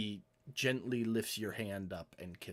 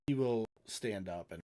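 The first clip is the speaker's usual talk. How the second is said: ordinary delivery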